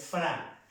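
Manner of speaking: "fra" with a plain open A; wrong open A. Shown as a demonstration. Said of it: "no es fra". ("fra" con A abierta, sin nasal)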